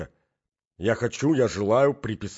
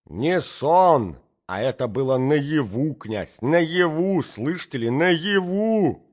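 loud, high, furious insistence; indignant mother, louder and higher to the end; raging mother; 7 semitones higher, 6 dB louder, slower than usual. A narrator's reading. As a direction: hammer the word home in fury.

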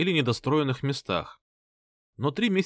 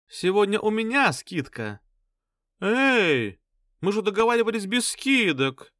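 squabbling flirty women; 10 semitones higher, 3 dB louder than usual. Acted. Prostitutes squabble.

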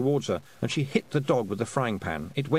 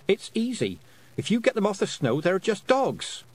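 put-on higher-pitched, confident girl's voice; brisk heroine making light of it; plucky young heroine Tiffany; 9 semitones higher than usual. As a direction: shrug off the danger in a higher voice.

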